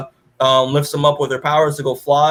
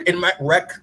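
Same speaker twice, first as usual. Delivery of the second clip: ordinary delivery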